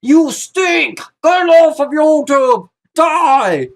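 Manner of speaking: loud, high hammed-up hater voice; mock troll comment. Vlogger imitating nasty commenters, high hammed-up yell. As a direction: shout it like a sneering commenter. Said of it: "everyone is either".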